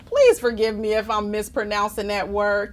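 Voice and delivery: ordinary delivery, nothing marked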